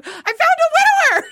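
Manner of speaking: very high, excited squeal; thrilled, sky-high exclamation; excited announcement. Retold or imitated. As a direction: blurt it out in a giddy high pitch. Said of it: "exclamation points 'cause I'm so excited".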